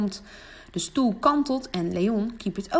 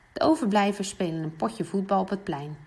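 ordinary delivery, nothing marked

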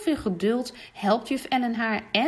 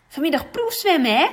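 higher and louder, eager; enthusiastic call; 8 semitones higher, 7 dB louder than usual. Acted. Jan calling to classmates about the swim test, enthusiastic. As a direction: call it out to your classmate with excitement. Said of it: "roept Jan enthousiast".

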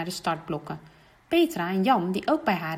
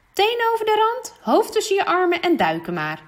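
much higher and louder commands; brisk swim instructor; 11 semitones higher, 7 dB louder than usual. Acted. Swim teacher instructing Renske to dive, brisk and loud. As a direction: bark the diving steps.

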